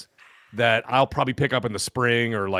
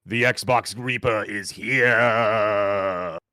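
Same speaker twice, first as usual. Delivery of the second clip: low, slow grim reaper voice; ghost voice; 3 semitones lower, slower than usual. Acted. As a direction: intone doom like a spectral reaper. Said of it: "you kinda sound like the ghost of Christmas Past".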